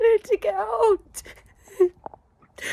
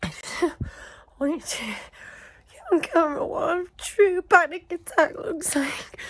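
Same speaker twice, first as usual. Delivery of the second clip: panicked crying; 7 semitones higher than usual. Real, not acted. Filmed during a real panic attack.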